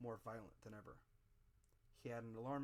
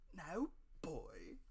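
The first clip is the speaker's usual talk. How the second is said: menacing beggar; 5 semitones higher, 5 dB louder than usual. Acted. Beggar grips the boy.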